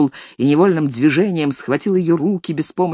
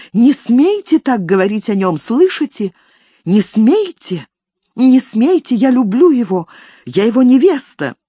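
loud, higher furious defense; indignant outburst; 5 semitones higher, 4 dB louder than usual. Acted. Elis shouting at the nanny in his defense, loud. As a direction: defend him fiercely, shouting.

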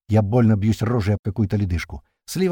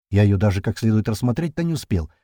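ordinary delivery

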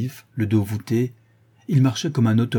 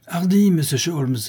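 higher, raised call across the water; shouted encouragement; 7 semitones higher than usual. A narrator's reading. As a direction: yell encouragement to a swimmer. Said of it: "hurla le brigadier".

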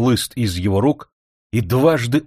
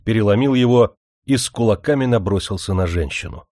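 ordinary delivery, nothing marked

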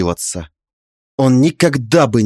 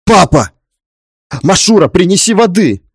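loud, urgent cry for help; panicked call; 5 semitones higher, 4 dB louder than usual. Acted. Son seeing father collapse, panicked. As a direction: call out in alarm, pitch rising. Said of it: "Запаниковал Алихан".

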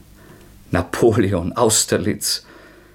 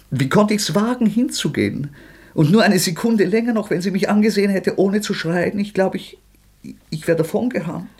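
high, loud and racing, falling apart; frantic outburst; 9 semitones higher, 4 dB louder than usual. Acted. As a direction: pour it out in a panic, voice climbing. Frantic outburst recalling the murder.

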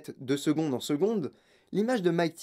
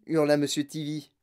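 ordinary delivery, nothing marked

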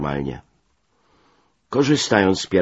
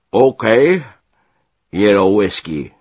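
loud, raised officer's voice; brash American officer; 4 semitones higher, 9 dB louder than usual. A narrator's reading. Major nicknames the newcomer, brash and loud. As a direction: call it out like a brash commander. Gruff major addresses narrator, acted.

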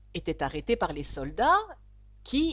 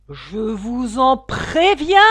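slow, much louder furious queen; queen threatening the duchess, shouting; angry shout; 3 semitones higher, 12 dB louder, much slower than usual. Acted. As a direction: bellow the warning. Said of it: "cria la reine".

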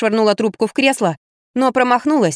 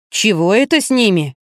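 ordinary delivery

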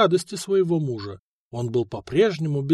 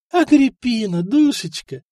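higher, louder sweet welcome; sugary greeting; 8 semitones higher, 5 dB louder than usual. A narrator's reading. Wife sweetly greets the neighbour she hates. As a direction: gush over her like a best friend. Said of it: "сладко улыбнулась Эльфрида соседке".

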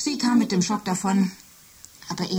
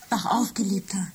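tender whisper. Acted. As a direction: coax him awake gently. Machine wakes sleeping Michael. (zärtliches Flüstern)

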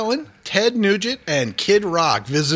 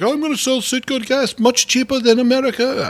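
higher voice, a hint of a Middle Eastern accent; 9 semitones higher than usual. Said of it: "I sound like one of those people out in sand country".

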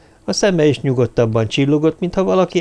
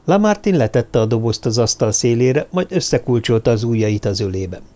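ordinary delivery

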